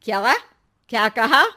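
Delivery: husband startled by wife's words; surprised. Acted. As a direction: ask back in disbelief. Husband surprised by her remark.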